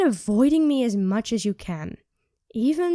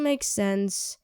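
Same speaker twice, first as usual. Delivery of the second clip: normal talking voice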